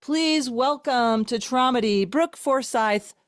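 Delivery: slow, upbeat host introduction; announcer. Acted. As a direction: introduce the guest like a showman. Hosts the guest introduction.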